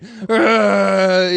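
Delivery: loud, high strangled roar; hammed-up spewing like a possessed girl; a mock demonic heave, loud and high; Exorcist-style vomit roar. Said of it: "like the fucking, uh, Exorcist".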